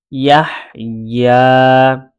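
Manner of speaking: ইয়াহ leading directly into long-held ইয়া; connected syllable chant; letters read together. Shown as a demonstration. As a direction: link ইয়াহ and ইয়া in one slow phrase. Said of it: "আমি দুইভাবে পড়ে".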